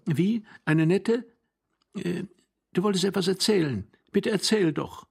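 put-on high-pitched, questioning young wife. Young wife urging her old husband to tell, high and insistent. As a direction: lift the voice high and prod him along. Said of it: "sagte seine junge Frau".

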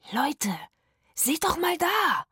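high gasping call. Acted. Rufus spotting the pool, high breathless gasp. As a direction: gasp it out in wonder. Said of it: "japste Rufus".

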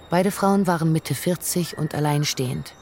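ordinary talk, nothing marked

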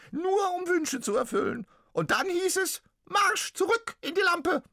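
very high, loud squeaky ghost cry; pitiful little ghost. Acted. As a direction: wail it like a tiny trapped ghost. Ghost explains.

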